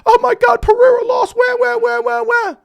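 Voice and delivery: a shrieking baby-voiced fanboy bawling over Pereira; whiny fan parody. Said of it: "bitch shill that you wanna suck Daddy Dana".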